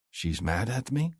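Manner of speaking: ordinary delivery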